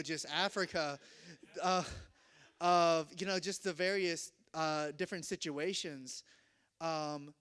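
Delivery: ordinary delivery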